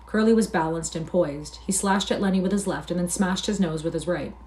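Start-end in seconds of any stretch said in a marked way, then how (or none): none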